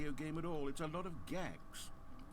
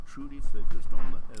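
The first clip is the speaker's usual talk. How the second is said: jolting shout; 22 dB louder than usual. Acted. Narrator jolting Stanley awake.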